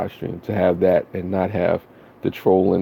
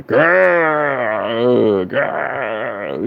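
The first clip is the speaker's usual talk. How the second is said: rough mock growl, a bit higher; playful growl; 5 semitones higher than usual. Streamer growling to salute hardcore subscribers. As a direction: growl it out like a hype beast.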